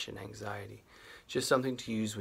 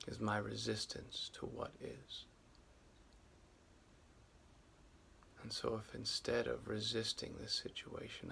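guided meditation; 8 dB quieter than usual. Acted. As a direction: guide the listener gently back to the breath. Leads a guided acceptance meditation.

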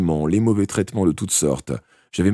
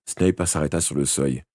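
plain reading voice

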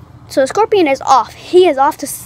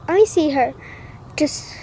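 usual speaking voice

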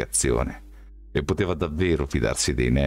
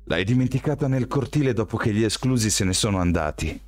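higher voiced nervous Miles; nervous young man; 6 semitones higher than usual. A narrator's reading. Miles explains to Daniel about the arrow, nervous higher voice. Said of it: "disse a Daniel".

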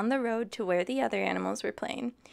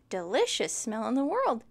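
ordinary delivery

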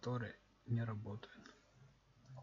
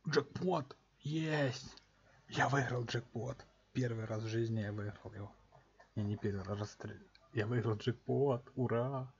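shrieking jackpot winner; 4 dB louder than usual. Real, not acted. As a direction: yell it like you just hit the jackpot. Wins jackpot in the game.

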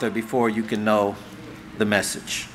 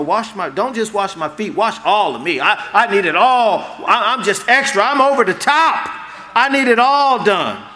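Peter pleading louder and higher, climbing to a near shout; over the top; 6 semitones higher, 4 dB louder than usual. Acted. Peter overeager to Jesus, begging loudly to be washed all over. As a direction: play the overeager disciple begging for more.

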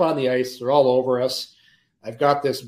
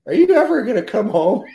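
higher, louder mock wife; imitating his wife; 10 semitones higher, 6 dB louder than usual. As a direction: play the wife nagging him lightly. Wife calling, voiced in story.

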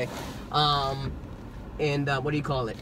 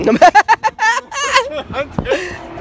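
loud high laugh; high laugh burst; 5 dB louder than usual. Real, not acted. Laugh at Amber Alert.

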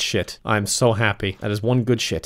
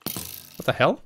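ordinary talk

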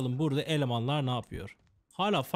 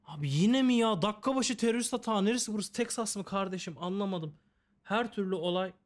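exasperated outburst; 7 semitones higher than usual. Real, not acted. Exasperated at constant terrorist attacks.

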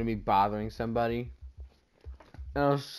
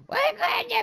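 squeaky voice. Pretend. Mocking squeaky voice.

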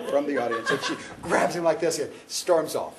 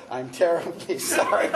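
laughing apology. Retold or imitated. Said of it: "look at Lamb Chop and go".

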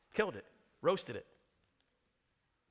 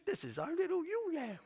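tender; 12 semitones higher than usual. Acted. Preacher voices lamb owner tenderly.

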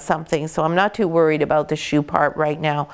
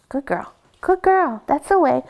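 puppy praise; 7 semitones higher than usual. Real, not acted. Trainer rewarding the puppy for letting go, high praise.

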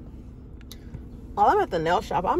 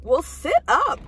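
higher, louder, slow scold to sit up; sharp scolding; 7 semitones higher, 4 dB louder, slower than usual. Retold or imitated. To her slouching date at dinner, a slow scolding order. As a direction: drag it out like a scolding mom. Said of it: "I'm like".

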